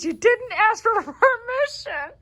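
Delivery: high, loud mock reprimand with a laugh; hammed-up indignation, breaking into laughter; sassy retold scolding. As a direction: imitate a bossy coach, drawn out and high. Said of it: "you were the one sassing".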